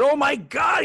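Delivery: high, loud delighted cry; light up on recognizing the name; retold burst of delight, shouted high; excited recognition. Singer recognizes the family name.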